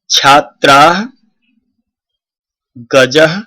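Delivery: each Sanskrit word said slowly and distinctly, with a long pause after each; clear model reading. Shown as a demonstration. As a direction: read each word crisply for the class to repeat. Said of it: "जैसे मैंने पढ़ा है वैसे ही". (स्पष्ट, रुक-रुककर उच्चारण)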